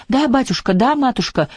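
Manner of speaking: high-pitched voices of obedient maids; servants in chorus. A narrator's reading. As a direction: chirp it high, as flustered servants. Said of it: "хором заговорили они". (высокие голоса служанок хором)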